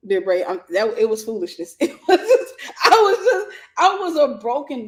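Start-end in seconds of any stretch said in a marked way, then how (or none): 1.8-4.9 high, loud self-mocking laughter; real laughing. Real, not acted. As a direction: laugh at yourself while talking. Laughing at her past self.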